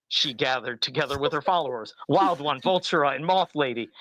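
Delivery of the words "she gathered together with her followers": usual speaking voice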